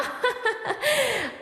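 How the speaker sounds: high-pitched falling laugh. Acted. Host as 늘크크 laughs at own corny line, high laugh. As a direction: let out a high little cackle. Laughs inside the character reading.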